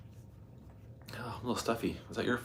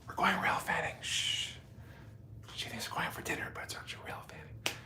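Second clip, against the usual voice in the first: conspiratorial whisper; 4 semitones higher, 15 dB quieter than usual. Real, not acted. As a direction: whisper it like a secret. Secret kept from wife.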